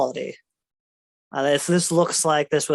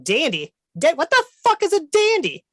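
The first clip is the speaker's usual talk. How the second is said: incredulous shrieking dragon; confused dragon; 12 semitones higher than usual. Acted. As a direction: squawk the name back in disbelief.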